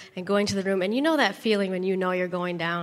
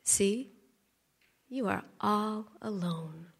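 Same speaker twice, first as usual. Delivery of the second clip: soft, slow taunting hush; sinister murmur; 8 dB quieter, slower than usual. Retold or imitated. The enemy's voice taunting the lonely wife, hushed. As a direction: let the lie creep in quietly. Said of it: "I heard that voice again".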